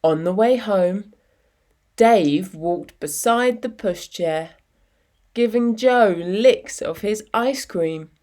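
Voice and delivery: ordinary delivery, nothing marked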